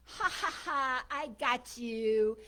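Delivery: slow, loud mock laugh, then crowing; gloating taunt. Bird taunts the woman who freed it, gloating. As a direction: crow it out, stretching each word.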